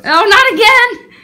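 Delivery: high, loud mock dismay; loud, high teasing groan; playful outcry. Real, not acted. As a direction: cry out in exaggerated protest. Mock protest at model pose.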